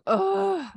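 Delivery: high-pitched retold whiny groan; reluctant groan. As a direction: groan at having to go.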